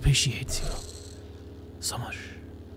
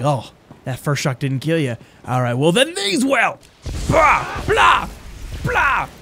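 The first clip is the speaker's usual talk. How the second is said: starts plain, rises higher and shouts the blahs; mock character taunt building to a shout; 3 semitones higher, slower than usual. Imitating his character zapping enemies, building to a mock shout. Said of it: "she's just like".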